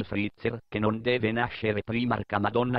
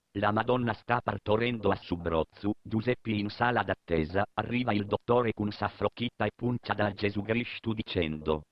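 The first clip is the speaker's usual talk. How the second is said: ordinary talk, nothing marked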